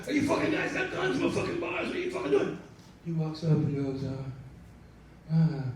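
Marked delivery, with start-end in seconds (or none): 0.1-2.6 high, frantic mock alarm; imagined panicked outburst. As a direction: run up in a hammed-up panic. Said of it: "you would think he'd run up and go".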